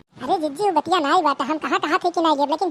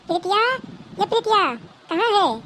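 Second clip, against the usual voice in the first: higher call to someone out of sight; raised voice calling Preetiya; 5 semitones higher, faster than usual. Acted. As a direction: call the girl from the next room. Calling a girl from afar.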